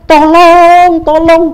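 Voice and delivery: high, loud desperate call for help. Acted. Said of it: "dikencangkan suaranya".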